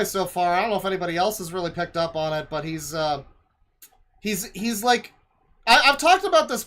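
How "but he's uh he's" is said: usual speaking voice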